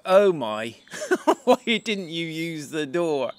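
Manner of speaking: laughing through a homeowner's complaint; amused mock dismay. Homeowner to men coming through roof, laughing mock dismay. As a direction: play the put-out homeowner while cracking up.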